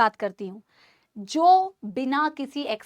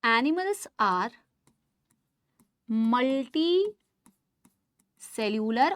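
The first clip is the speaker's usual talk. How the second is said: very slow, drawn-out dictation pace; dictating slowly; 3 semitones lower, 5 dB quieter, much slower than usual. Shown as a demonstration. Teacher dictates the first property to students, slow dictation. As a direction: stretch each word so they can write. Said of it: "सबसे पहली properties लिखने जा रही हूँ".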